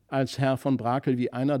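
usual speaking voice

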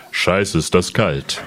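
low, slow grumbling kangaroo voice; tiefe Känguru-Stimme. Acted. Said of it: "mach den ersten Satz noch mal". (tiefe, langsame Känguru-Stimme)

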